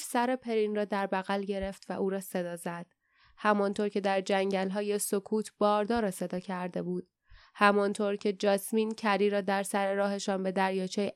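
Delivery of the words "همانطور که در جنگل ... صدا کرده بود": usual speaking voice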